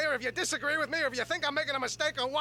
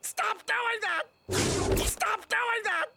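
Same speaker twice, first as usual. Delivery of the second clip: high, drawn-out protest; exasperated yell; 7 semitones higher, slower than usual. Acted. Man to selves who echo him, exasperated yell. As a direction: yell each word high and fed up. Yells at the selves answering early.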